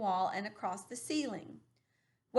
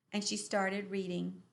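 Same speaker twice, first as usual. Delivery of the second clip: plain reading voice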